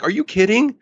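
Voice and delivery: high, disbelieving "Are you kidding?"; incredulous. Retold or imitated. Speaker answers shocked dentists, high and incredulous. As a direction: squeak it out in mock disbelief. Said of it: "and I'm like".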